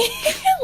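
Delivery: sudden squealing laugh; high burst of laughter. Real, not acted. Podcast host cracking up at Bella's rudeness, high laugh.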